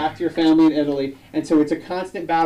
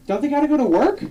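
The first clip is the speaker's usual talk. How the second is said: high puzzled mom voice, pitched up; 10 semitones higher than usual. Retold or imitated. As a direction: play the baffled mother asking. Mom's puzzled question, acted quote.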